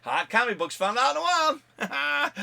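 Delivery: high, loud hyped show intro; big channel-opener announcer voice. Acted. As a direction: pump up the show title like a host.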